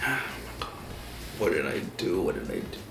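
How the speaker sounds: very soft, low self-questioning; horrified muttering. Retold or imitated. Kid walking up to the priest, horrified muttering. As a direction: murmur it to yourself, barely audible.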